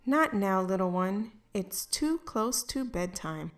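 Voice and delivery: soothing mother easing her daughter toward bedtime; tender bedtime mother. Acted. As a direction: hush it down like tucking a child in. Mother putting Maisie to bed.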